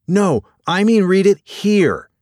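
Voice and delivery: high, loud, exasperated urgency; insistent correction. Acted. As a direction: cut him off and insist. Docent cuts curator off, insisting.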